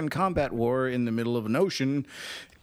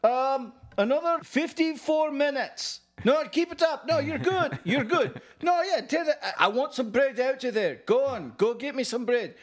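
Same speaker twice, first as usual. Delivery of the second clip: high, hollered mock rioter voice; comic high-pitched timekeeper shout; rioter calling out; 12 semitones higher than usual. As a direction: call the time out high over a crowd. Acted rioter calling out time.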